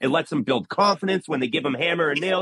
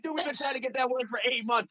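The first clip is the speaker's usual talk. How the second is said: high, softer voice of disbelief; retold amazement; 8 semitones higher, 6 dB quieter than usual. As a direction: marvel at the kid in a raised pitch. Astonished kid fixed broken system.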